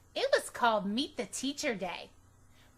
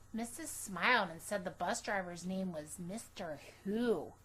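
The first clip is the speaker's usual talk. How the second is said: ordinary delivery, nothing marked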